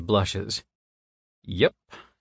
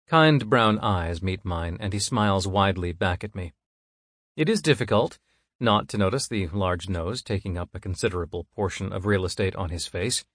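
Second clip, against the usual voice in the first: usual speaking voice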